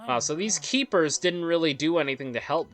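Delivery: ordinary talk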